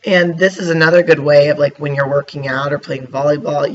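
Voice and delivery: usual speaking voice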